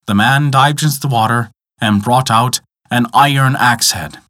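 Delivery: usual speaking voice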